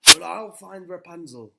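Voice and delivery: very loud, high defiant yell; very loud vow; shouted vow. Acted. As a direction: swear it to the witch at the top of your voice. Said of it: "he shouted to the witch".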